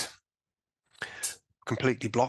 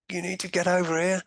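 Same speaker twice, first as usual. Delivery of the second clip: retold yell, pitched up; half-voiced call across a ward; 7 semitones higher, 4 dB louder than usual. Patient calling the surgeon over, insistent. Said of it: "yelled out his name".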